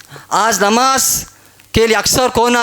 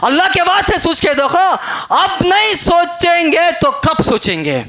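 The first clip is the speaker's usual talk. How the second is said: raised, emphatic sermon appeal; urgent preaching; 4 semitones higher than usual. Acted. Preacher urging worshippers to think, raised insistent voice. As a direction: stretch and punch the words as you exhort.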